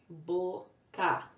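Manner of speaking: Boca split gently into Bo, then ca; syllable by syllable. Shown as a demonstration. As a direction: say Bo, pause, ca without pushing. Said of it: "Duas sílabas".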